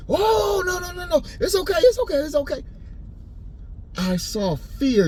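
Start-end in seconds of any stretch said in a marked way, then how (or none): 0.1-2.6 high, frantic reassurance; panicked pleading. Retold or imitated. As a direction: plead with him to stop, fast. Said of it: "They like".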